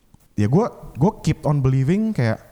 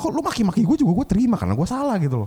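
much higher, indignant scolding; heated mock rant; 11 semitones higher than usual. Scolding the sous chef, heated and indignant. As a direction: let the anger spill over. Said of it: "gue panas lah".

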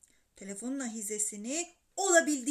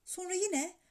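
ordinary delivery

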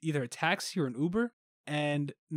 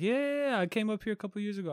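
a light Guyanese lilt on a much higher, faster "yeah yeah yeah"; lightly imitating the Guyanese cab driver, pitched up and quick; Guyanese driver's accent; 6 semitones higher, faster than usual. Friend mimics the Guyanese taxi driver's reply, a light Guyanese accent. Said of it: "I heard it in your voice".

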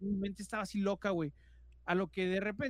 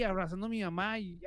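usual speaking voice

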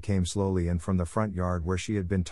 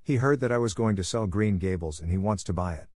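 usual speaking voice

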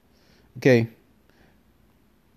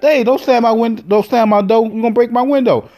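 high, pitched-up mock yell; retold yell; 8 semitones higher than usual. To his date slamming the car door, high mock yell. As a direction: protect your car, yell at her lightly.

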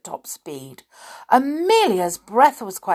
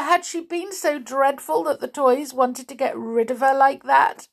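plain reading voice

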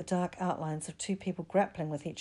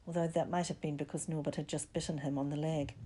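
ordinary delivery

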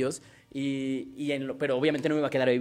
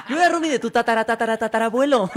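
cockroach voice; 7 semitones higher, 5 dB louder than usual. Pretend. Teasing his cohost as a cockroach, high laughing voice. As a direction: play the cockroach greeting its old roommate.

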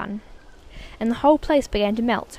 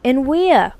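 put-on a louder, eager cry from a curious boy; cried; 4 semitones higher, 8 dB louder than usual. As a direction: blurt it out, impatient to know. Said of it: "cried Charlie".

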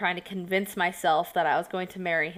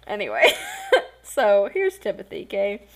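laughing; 5 dB louder than usual. Real, not acted. Laughing while introducing him.

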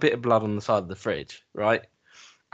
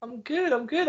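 mock cheerful; 13 semitones higher than usual.

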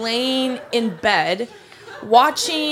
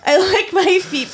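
laughing through the words, a bit louder; 7 semitones higher, 5 dB louder than usual. Real, not acted. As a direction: giggle while saying it. Laughs mid-story about new feet.

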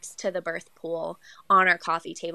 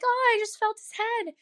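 dazed self-quote. Retold or imitated. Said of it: "I'm like".

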